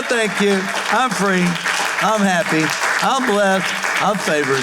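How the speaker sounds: high, driving preacher's cry; fiery preaching. Acted. Pastor rallying the church against the devil, full preaching force.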